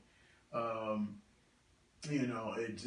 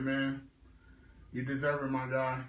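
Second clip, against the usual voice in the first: ordinary delivery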